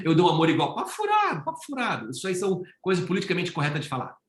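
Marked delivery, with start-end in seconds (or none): 0.7-2.1 high scoffing twice, then dropping; mocking "papo furado" said high then falling. Real, not acted. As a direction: wave it off as nonsense. Repeats dismissal, high then low.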